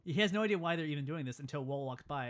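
normal talking voice

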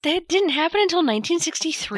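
high pitched shocked question; can't believe what she's hearing; surprised disbelief. Real, not acted. Disbelief at zip codes date.

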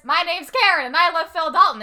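hammed-up public declaration; mock announcement. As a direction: proclaim it loudly for everyone to hear. Acting Karen announcing to the theater.

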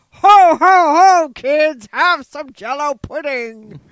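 loud, slow, booming ho-ho-ho; belly-laugh it like a tired mall Santa; bad mall Santa for the kids. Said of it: "like a mall Santa?".

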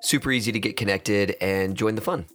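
loud, steady announcer outro; podcast outro read. Acted. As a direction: read the sign-off like an ad. Outro read over music.

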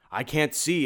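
high, loud monster wail; panicked cry. A narrator's reading. Blinded monster struggles under the cloak, panicked cry. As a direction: cry out in alarm. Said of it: "it cried".

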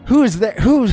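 high-pitched frantic calling; George Piston crying out. Acted. Man swallowed by creature cries out in the dark, high-pitched. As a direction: call out high into the darkness. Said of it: "who cries out in the darkness".

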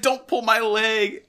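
a hint of scolding kids, slow and high; kids scolding narrator. Kids in the show scolding the narrator, lightly mocked. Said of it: "it's like".

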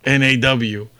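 ordinary talk

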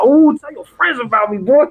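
high, playful crowing taunt; hyped-up catchphrase. Retold or imitated. Imitating a gamer after a combo, high taunting crow. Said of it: "Every time you do some kind of like".

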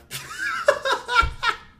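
high, loud peal of laughter; real burst of laughter. Real, not acted. Podcast co-host cracks up at the joke, laughing hard.

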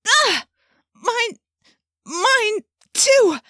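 very high, strained outcry; shrieked halting words in distress; horrified cry. A narrator's reading. Missus Chapman finding her host rebelling too, high strained cry.

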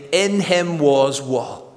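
ordinary talk, nothing marked

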